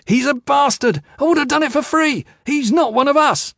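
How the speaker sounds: very high, loud tirade; shouted outburst. A narrator's reading. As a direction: yell it in a furious outburst. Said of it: "Aram shouted".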